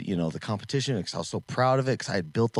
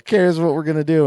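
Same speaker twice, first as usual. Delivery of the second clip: put-on loud, high, fast take-charge kid; bossy kid; 9 semitones higher, 11 dB louder, faster than usual. The boy telling his dad the plan, loud and high. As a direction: boss the project like an excited kid.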